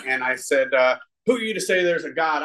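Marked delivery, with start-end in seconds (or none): 1.3-2.4 retold angry challenge. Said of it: "I was just angry".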